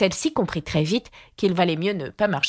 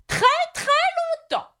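high-pitched, stubborn knight repeating himself; insistent knight; faster than usual. A narrator's reading. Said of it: "répéta-t-il sans arrêt". (chevalier obstiné, voix haut perchée)

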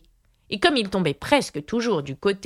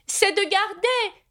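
high, drawn-out proclamation; declaiming; slower than usual. A narrator's reading. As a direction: proclaim it slowly in a high knight voice. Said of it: "d'une voix forte". (déclamation aiguë et lente)

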